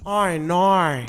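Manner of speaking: big, drawn-out Australian "oh, no"; Australian accent. Shown as a demonstration. Repeating the demo for co-host, exaggerated Australian. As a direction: trumpet the vowels in broad Australian. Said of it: "make your mouth like a trumpet".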